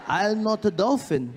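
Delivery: indignant Japanese man; loud high mock Japanese accent. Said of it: "Japanese guy replies".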